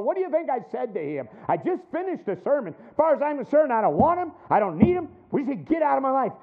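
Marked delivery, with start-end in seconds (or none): 2.9-6.3 loud, higher, fed-up rejection; loud, snapping refusal; angry retort. Retold or imitated. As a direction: push him away in a rage. Imagined angry retort to helper.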